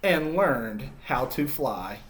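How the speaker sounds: put-on storytelling to kids as Humpty, lively and expressive; story narration. Teacher reading Humpty's story to children, expressive narration. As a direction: read it like a picture book to a class. Reads picture book to children.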